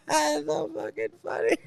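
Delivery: high, squeezed laughing voice; helpless laughter. Real, not acted. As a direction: wheeze it out while cracking up. Overcome by laughter.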